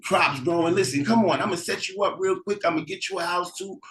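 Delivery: high, loud, fast greeting to a brother; excited welcome. Acted. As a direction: gush like you finally see family. Brother greets arriving sibling.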